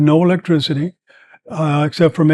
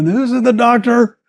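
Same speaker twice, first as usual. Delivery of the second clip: translating the commander's cry, raised high; commander's shout; 7 semitones higher than usual. Retold or imitated. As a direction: proclaim it like a rally speaker.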